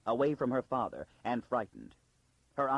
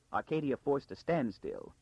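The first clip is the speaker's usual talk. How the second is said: ordinary delivery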